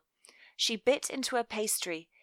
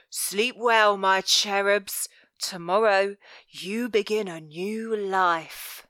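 slow, louder sinister goodnight; velvet voice; 4 dB louder, slower than usual. Acted. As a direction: purr the threat slowly. Velvet's parting words.

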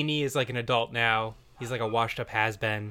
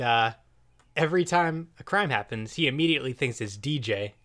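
ordinary delivery

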